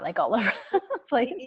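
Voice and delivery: laughing through the words; a real laugh breaks into the line; amused, laughing as she speaks. Real, not acted. Laughing while describing chaos.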